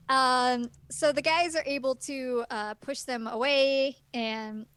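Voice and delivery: ordinary talk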